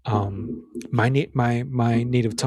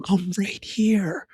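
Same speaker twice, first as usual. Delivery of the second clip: whisper; 7 semitones higher, much slower than usual. Retold or imitated. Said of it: "And Nick goes".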